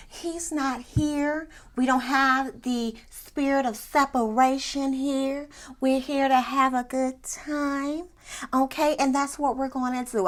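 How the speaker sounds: hushed, drawn-out, airy composure; mock soothing. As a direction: imitate someone serenely smoothing things over. Voices Mel gently closing the conversation.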